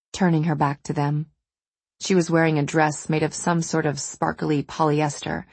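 ordinary delivery

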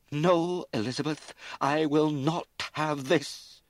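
high, loud, slow furious outburst; sudden fury. A narrator's reading. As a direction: forbid it with full rage. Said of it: "her mother's sudden fury".

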